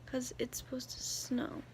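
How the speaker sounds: hushed whisper. Real, not acted. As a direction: keep it to a whisper.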